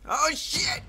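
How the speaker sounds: panicked yelp. Real, not acted. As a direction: yelp it in real alarm. Game panic, repeated yelps.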